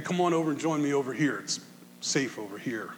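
soft, quick, inviting call to come over; gentle invitation. Acted. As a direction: coax them over gently, the last words almost hushed. God voiced inviting by riverbank.

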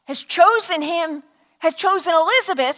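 ordinary talk

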